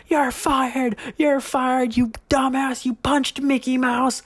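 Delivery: a hint of a frantic tour guide firing him; tour lady yelling. Tour lady firing the new guy, mild mock yell. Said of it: "she just started yelling".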